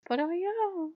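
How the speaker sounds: high-pitched mock insistence; protesting comeback. His protest acted in reply.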